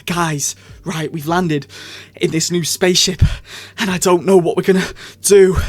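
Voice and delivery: a hint of a breathless, rushed Doctor; Jodie Whittaker breathless. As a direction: gabble it like a flustered Doctor short of air. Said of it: "She's always out of breath".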